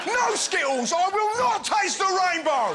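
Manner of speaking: high, loud mock-defiant proclamation; mock heroic shout. Host parodying outraged public, hammed-up defiant shout. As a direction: bellow it like a hammed-up rallying cry.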